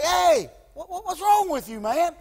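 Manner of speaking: high-pitched, puzzled let-down call; acting out a disappointed God calling someone out. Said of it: "God was just kinda like".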